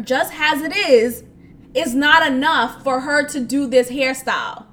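ordinary talk

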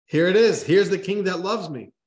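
a fish who can't contain its joy; thrilled carp sure it is loved; excited carp. A narrator's reading. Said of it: "the carp can barely contain its excitement".

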